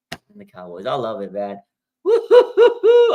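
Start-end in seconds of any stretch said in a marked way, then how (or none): 2.0-3.1 long high loud whoop; hype whoop. Real, not acted. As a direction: whoop in slow bursts.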